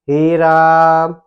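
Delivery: loud, very slow हे राम on a level held tone; forceful, drawn out. Shown as a demonstration. Teacher repeats the class example, हे राम loud and prolonged. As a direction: hold both vowels of हे राम long. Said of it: "कितना कस के बोलते हैं".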